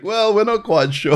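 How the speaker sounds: unsure voice. Retold or imitated. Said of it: "being like".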